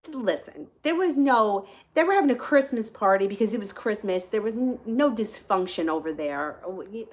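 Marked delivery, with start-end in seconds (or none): none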